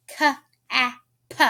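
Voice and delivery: "K-A-P" as kuh, aah, puh; sounded out. Shown as a demonstration. Teacher testing children's ears, "K-A-P" sounded kuh, aah, puh. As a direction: separate "K-A-P" into three letter sounds with gaps. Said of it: "Do you hear an I sound?".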